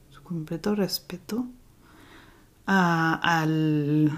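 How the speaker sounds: soft whispered echo of su completo respeto. Shown as a demonstration. The victim gives full respect, whispered echo. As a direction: breathe the words out, voiceless, for weight. Repeats phrase, then whispers it.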